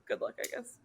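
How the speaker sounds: soft whispered shrug. Real, not acted. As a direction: say it under your breath. Dismissive aside.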